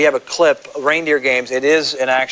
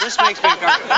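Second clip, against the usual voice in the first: much higher, teasing repeat; high mock voice; faster than usual. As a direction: echo it in a high comic voice. Mocking repeat of own line.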